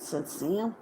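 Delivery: ordinary delivery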